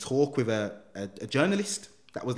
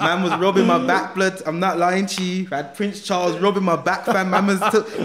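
loud, high, excited telling; amused disbelief; 7 semitones higher, 8 dB louder than usual. Real, not acted. Guest telling the host about a royal back rub, loud and incredulous. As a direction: tell it loudly, breaking into laughter. Laughing, excited retelling of being comforted.

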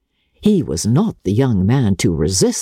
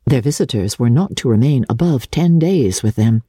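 plain reading voice

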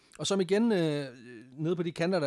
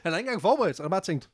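ordinary talk, nothing marked

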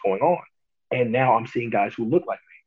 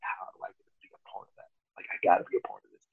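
hushed, half-whispered inner voice; barely voiced private wish; inner thoughts; 2 semitones lower, 8 dB quieter than usual. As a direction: say it softly, like thinking aloud to yourself. Imagining joining, in his head.

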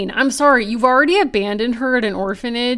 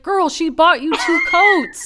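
slow, higher, laughing through an exasperated yell; exasperated laughing shout; 6 semitones higher, 3 dB louder than usual. Retold or imitated. Podcast host scolding a clueless heroine, laughing exasperated shout. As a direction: laugh it out in disbelief at her.

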